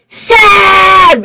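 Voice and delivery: high, very loud stretched-out name; retold scream. Screaming to wake her friend, retold full-voiced scream. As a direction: scream it right into someone's face.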